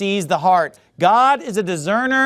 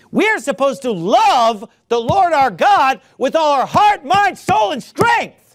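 louder and higher, building to a peak on heart, mind, soul, and strength; preaching at full force; 6 semitones higher, 3 dB louder than usual. Real, not acted. Preacher rousing his congregation, rising to a loud climax. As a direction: let the last words ring out like a preacher's climax. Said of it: "Preach it".

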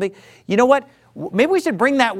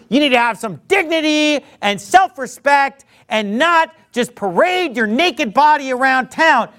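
louder, higher fiery preaching; preaching at full force; 5 semitones higher, 3 dB louder than usual. Acted. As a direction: thunder it from the pulpit.

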